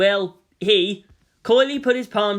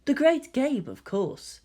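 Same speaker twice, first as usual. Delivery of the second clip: sniffy; 7 semitones higher, slower than usual. A narrator's reading. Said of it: "the hybrid sniffed".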